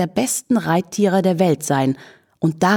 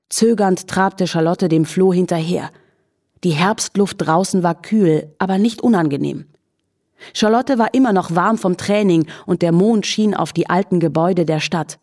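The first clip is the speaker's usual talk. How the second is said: ordinary delivery, nothing marked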